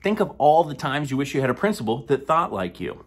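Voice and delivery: normal talking voice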